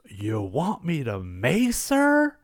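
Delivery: hammed-up gleeful offer, stretching the last words; giddy excitement. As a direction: imitate an eager kid, slow build to a high, loud finish. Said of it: "He's all giddy and excited".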